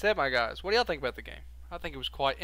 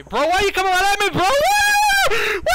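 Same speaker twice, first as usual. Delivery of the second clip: high frantic screaming; panicked shouting; 14 dB louder than usual. Real, not acted. Monster charging at player.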